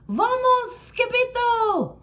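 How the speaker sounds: crowd crying out. Acted. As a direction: shout the warning up high. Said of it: "they cried".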